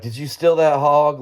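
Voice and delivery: all excited. Retold or imitated. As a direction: blurt it out thrilled. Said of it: "Like, all excited".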